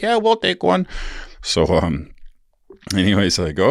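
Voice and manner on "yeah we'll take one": put-on high girly voice, much higher; girls' voice. Imitating the girls accepting a drink, high girly voice. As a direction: chirp it like the girls in the hot tub. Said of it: "they're like".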